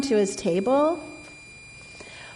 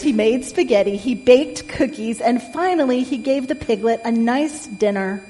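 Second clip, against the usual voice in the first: ordinary delivery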